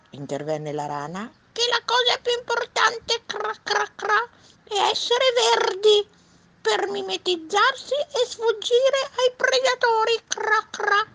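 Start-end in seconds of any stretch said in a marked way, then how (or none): 1.5-11.1 very high, loud frog voice; croaking frog. Acted. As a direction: croak like a shrill little storybook frog. Frog voice in read story. (vocina acutissima da rana)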